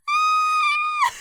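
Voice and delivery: shrill squeal of delight; high shriek. Retold or imitated. Flustered fan squealing at the artist's compliment, loud and high. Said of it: "I was like".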